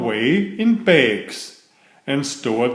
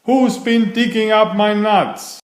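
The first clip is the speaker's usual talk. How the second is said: raised, higher squirrel taunt; mild mock shout; 7 semitones higher than usual. Squirrels chasing Timmy to his nest, mild mock shout. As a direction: call it out like an angry crowd. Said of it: "a crowd of squirrels shouting".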